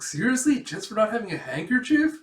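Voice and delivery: acted student indignation; incredulous protest. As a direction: object in outraged astonishment.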